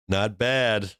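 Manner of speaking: ordinary delivery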